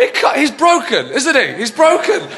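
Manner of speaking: ordinary talk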